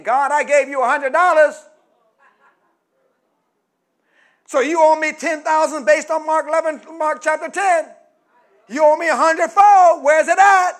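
loud mock demand, God owes me; hammed-up greedy giver shouting at God. Preacher mocking a giver demanding payback, loud.

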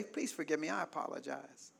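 soft, low, hushed plea for forgiveness; calm apology. Shown as a demonstration. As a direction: demonstrate a calm, disarming apology, very softly. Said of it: "just calmly say".

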